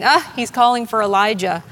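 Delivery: loud jeer from an unrelenting crowd; mocking. Crowd mocks Jesus calling out, loud scoffing. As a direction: scoff loudly at the dying man. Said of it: "unrelenting crowd still mocks him".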